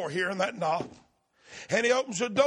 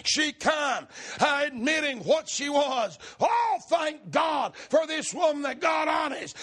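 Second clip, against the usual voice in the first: preaching at full force; 6 semitones higher than usual. Acted. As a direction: lift the crowd with each line. Preacher exhorting congregation.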